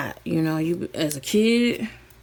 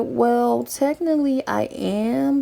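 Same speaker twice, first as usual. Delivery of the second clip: higher, put-on know-it-all voice; sassy; 6 semitones higher, slower than usual. Answering siblings who doubt her, sassy higher know-it-all voice. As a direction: lay it on smugly. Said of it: "And I was like".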